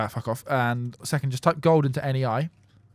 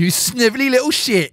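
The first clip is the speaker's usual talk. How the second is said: higher put-on voice, sneering; hammed-up toff scolding; mock posh insult; 7 semitones higher than usual.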